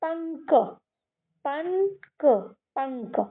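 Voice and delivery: "पंक" softly, nasal n held before a separate k; sounded out. Shown as a demonstration. Teacher decoding the first part for kids, "पंक" softly sounded out. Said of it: "तो मैं इसको कैसे".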